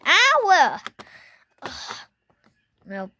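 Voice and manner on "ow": pained ow. Real, not acted. Sudden pain cry.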